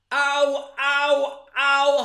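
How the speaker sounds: loud, high-pitched "ow"s; hammed-up cries of pain; mock pained yelps. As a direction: yelp as if scalded by a cup. Mock pain while holding a cup.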